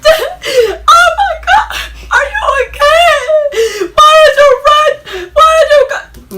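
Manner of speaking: high, slow, loud wailing shout; imitating mom crying. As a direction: wail it like a frantic mom. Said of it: "she just crying".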